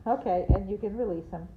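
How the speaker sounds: ordinary talk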